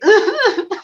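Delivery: high, overdone fake sob; mock sobbing. Mocking actors who sob on their reels, overdone fake sob. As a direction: imitate an actor's showy crying. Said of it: "like that kind of crying".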